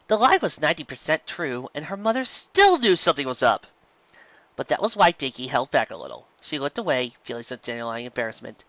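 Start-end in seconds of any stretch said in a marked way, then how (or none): none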